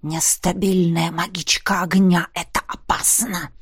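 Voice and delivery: hissing snake-like whisper; змеиное шипение. Acted. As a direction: hiss it like a serpent. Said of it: "как змея прошипел некромант". (шипит как змея)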